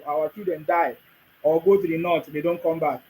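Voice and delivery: ordinary delivery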